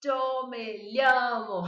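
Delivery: llamo said as lhamo, the way not to say it; slow warning demo of lhamo; mock wrong pronunciation; wrong lh sound. Shown as a demonstration. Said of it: "Mas não falem".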